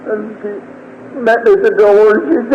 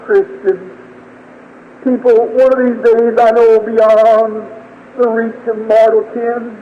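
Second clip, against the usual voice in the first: slow, drawn-out, higher-pitched recitation; fervent poetic preaching; 5 semitones higher, slower than usual. Acted. Preacher reciting his hope of heaven to the congregation, slow and fervent. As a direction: stretch each phrase like a solemn hymn verse.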